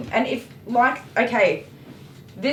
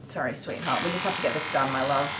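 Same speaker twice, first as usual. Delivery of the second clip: softer and lower, soothing a little dog; soft to the puppy; 7 semitones lower, 6 dB quieter than usual. Real, not acted. Apologizing to her puppy while working, soft and low. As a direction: murmur an apology to your pet.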